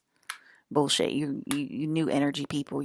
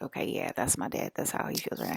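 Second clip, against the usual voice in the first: mumbled quote; 10 semitones lower, 5 dB quieter than usual. Retold or imitated. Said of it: "And then I was like".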